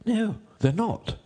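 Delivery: slow, very high rabbit voice. A narrator's reading. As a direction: answer slowly, pitched right up.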